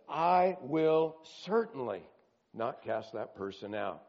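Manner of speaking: slow, heavy stress on I will certainly; emphatic promise. Acted. Preacher repeating Jesus' promise, slow and emphatic. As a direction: press every word, I will certainly, slowly. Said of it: "he gets emphatic".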